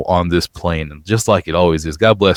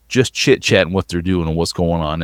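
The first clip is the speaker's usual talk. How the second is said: usual speaking voice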